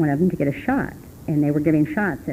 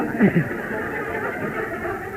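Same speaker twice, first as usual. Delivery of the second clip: an airy, high burst of laughter; real unvoiced laugh; breathy high laugh. Real, not acted. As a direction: laugh in disbelief. Laughs recalling coffee shop talk.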